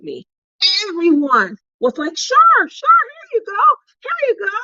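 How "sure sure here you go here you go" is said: high, sing-song sure sure here you go; high imitating voice; mock sweet offering. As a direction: imitate eager friends handing it over. Retelling others sharing cowtails.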